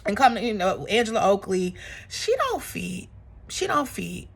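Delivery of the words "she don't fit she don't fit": sly dig. Real, not acted. Mocking Angela.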